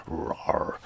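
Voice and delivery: a soft voiceless mock roar, barely there; breathy whispered roar. Shown as a demonstration. Imitating the shark's roar for the listener, whispered. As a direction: breathe the word Roar out without voice like a tiny shark. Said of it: "give it a shark roar".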